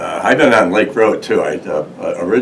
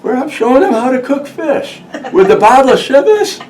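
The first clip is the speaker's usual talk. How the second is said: tipsy boss; 6 dB louder than usual. Retold or imitated. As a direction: play the merry drunk boss, pitched way up. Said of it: "he goes".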